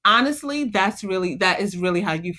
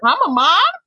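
high, incredulous squeal; disbelief; 7 semitones higher, 4 dB louder than usual. Retold or imitated. Looking at her kids, high and incredulous. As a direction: sound like you can't believe it. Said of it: "I look at my kids and I'm like".

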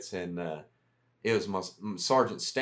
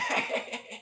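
high breathy laugh; 12 semitones higher than usual. Real, not acted. Laugh after impression.

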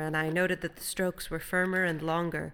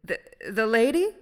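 loud, halting, raised question; abrupt question; 5 semitones higher, 10 dB louder than usual. A narrator's reading. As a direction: break in abruptly, stumbling on the first word. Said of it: "said Will abruptly".

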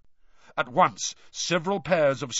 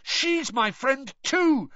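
high, drawn-out protest; desperate yell; 13 semitones higher, slower than usual. A narrator's reading. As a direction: yell it slowly, pitched right up. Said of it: "Harry yelled".

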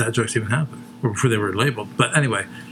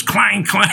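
clanging scale noise; 10 semitones higher, 5 dB louder than usual. Retold or imitated. Imitating the old scale.

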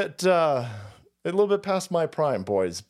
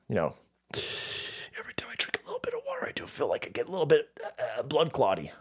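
mock whisper confession; 4 semitones lower, 8 dB quieter, faster than usual.

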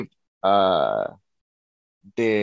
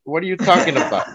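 higher-pitched, laughing retold question; 8 semitones higher, 4 dB louder than usual. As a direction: break into a laugh while quoting yourself. Retold reply, breaks into laughter.

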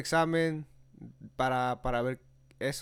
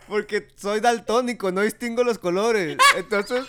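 laughing; 6 semitones higher, 9 dB louder than usual. Real, not acted. Laughing while confessing colorblindness.

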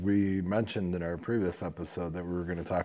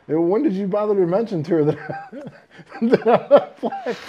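high, loud question broken by laughter; laughing outrage; 11 semitones higher, 8 dB louder, slower than usual. Retold or imitated. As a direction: laugh through the indignation. Retelling angry laughing question.

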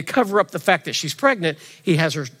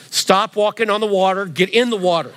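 higher, louder mock mom scolding; exasperated mother; 6 semitones higher, 6 dB louder than usual. Pastor voicing Mary scolding toddler Jesus, higher and louder. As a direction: play a fed-up mom at bath time.

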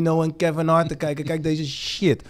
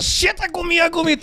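higher, urgent panicked cry; warning a friend the prisoner approaches, panicked; panicked whisper-shout; 11 semitones higher than usual. Retold or imitated. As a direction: alarm your friend that he's coming. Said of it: "ik zeg".

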